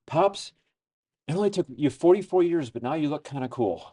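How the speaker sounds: high, bright teasing daughter voice; imitating daughter. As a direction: tease him like his grown daughter. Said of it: "she had this really funny comment where she said".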